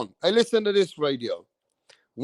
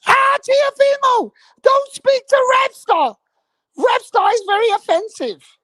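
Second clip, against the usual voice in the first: mocking screech; 5 dB louder than usual. Mocking HLD begging Teofimo, in a high mock scream. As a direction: shriek it like a panicking pundit. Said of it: "he was screaming his head off".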